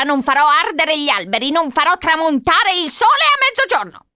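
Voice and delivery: high, loud commanding cry; shouted threat. A narrator's reading. Old lady threatening Charlie outside the locked door, shouting. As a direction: yell it as an old witch making threats. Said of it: "gridò la vecchia signora".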